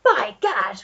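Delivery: put-on blustering old Duke exclaiming; old Duke crying out in alarm; Duke's cry. A narrator's reading. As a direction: bark it like a startled old aristocrat. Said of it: "cried the Duke".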